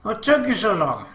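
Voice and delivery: indignant, high-pitched outburst; angry. A narrator's reading. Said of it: "Akemi asked us angrily".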